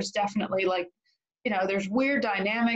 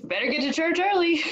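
laughing; 8 semitones higher than usual. Real, not acted. Joke about church capacity.

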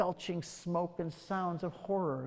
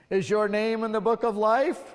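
louder, solemn question with a rising end; God questioning at judgment; thunderous God voice; 3 semitones higher, 6 dB louder than usual. Acted. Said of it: "God's thunderous voice".

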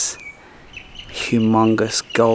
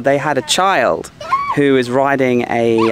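ordinary delivery

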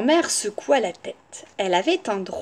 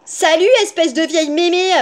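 put-on high, loud brash girl; brash, raised child's voice; cheeky shout; 6 semitones higher, 5 dB louder than usual. A narrator's reading. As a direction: call out a rude greeting. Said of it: "entra et cria".

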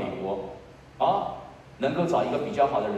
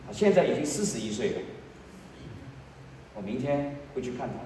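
ordinary delivery

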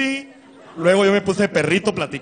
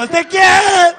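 very high, slow wailing shout of his name; high crying shout for Ezequiel; desperate shout; 12 semitones higher, 8 dB louder, much slower than usual. Retold or imitated. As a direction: call him across the street, wailing. Shouting in the desert.

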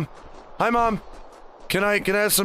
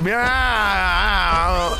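long drawn-out meow; playful sustained meow; cat meow. Pretend. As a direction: stretch out one big cat yowl. Playing a cat, meowing.

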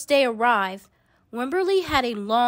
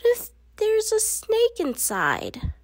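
put-on high, anxious child's question; worried little girl; 10 semitones higher than usual. A narrator's reading. Narrator voicing Wimberly, worrying about a snake. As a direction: fret like a small girl imagining danger.

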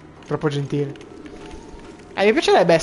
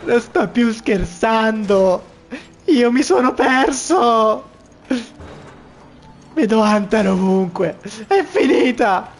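mock dramatic despair; 4 semitones higher, slower than usual. Dramatic despair about being lost.

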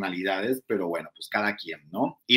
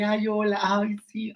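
high sugary greeting to the boss; mocking fake-sweet voice; 9 semitones higher, slower than usual. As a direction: play the two-faced flatterer. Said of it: "así es Flor Rubio".

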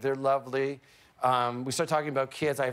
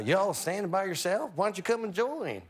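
higher-pitched, put-on Texas accent; 4 semitones higher than usual. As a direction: imitate a friendly Texan with a bad Texas accent. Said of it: "I'm gonna do a Texas accent".

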